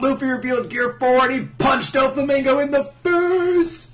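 high, loud announcer-style yell; hyped recap. Acted. Co-host recapping Luffy's punch, loud hyped yell. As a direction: shout it like the fight's big moment.

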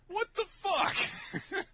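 very high, slow squeal of disbelief; incredulous squeal. Acted. Reacting to absurd lottery rule.

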